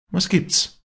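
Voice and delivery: high, loud short question; sharp question. A narrator's reading. Walli stopping before the servants, high loud question.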